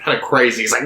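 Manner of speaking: ordinary talk, nothing marked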